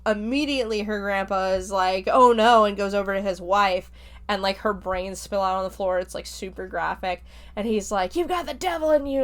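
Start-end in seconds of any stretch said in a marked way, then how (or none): 2.1-2.6 sudden loud cry of shock; a grandfather's loud gasp of dismay; retold cry of shock. Grandpa sees his shot wife. 8.1-9.2 higher, fast mock-furious accusation; imitating a grandpa's furious outburst; retold angry accusation.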